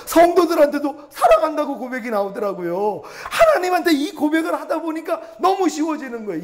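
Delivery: impassioned laughing sermon climax; fervent preaching. Acted. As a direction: preach it out with delight. Preaching at full force.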